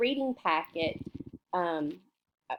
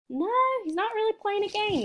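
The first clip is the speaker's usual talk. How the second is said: much higher, quick playful no; playful mock surprise; 12 semitones higher, faster than usual. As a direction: tease the kids gently. Teacher rejects wrong answer.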